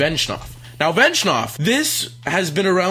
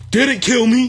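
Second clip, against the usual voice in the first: high, raised-voice gloat; taunting yell; 8 semitones higher, 6 dB louder than usual. Real, not acted. Gamer correcting his boast after surviving, taunting yell. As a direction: gloat loudly at the opponent.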